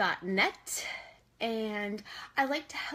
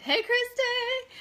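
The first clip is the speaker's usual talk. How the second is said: high, louder, slow hey; excited greeting; 12 semitones higher, 4 dB louder, much slower than usual. Real, not acted. Host greeting Christy, high drawn-out hey. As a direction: sing out the name warmly.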